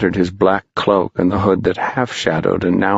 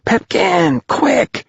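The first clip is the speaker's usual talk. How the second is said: much higher urgent call; much slower than usual. Acted. Boys calling Pipkin across the ravine, high and urgent. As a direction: hurry him on from afar.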